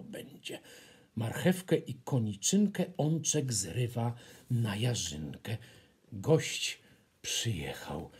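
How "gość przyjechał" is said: unvoiced, hushed announcement; low secret whisper. A narrator's reading. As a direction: whisper the big news.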